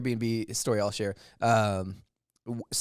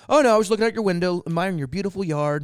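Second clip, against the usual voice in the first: high, loud, fast excuse; imitated drunk guest; 6 semitones higher, 7 dB louder, faster than usual. Drunk guest excusing himself to host, imitated eager rush. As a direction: gush it quickly as a mock drunk. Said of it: "like, very drunk".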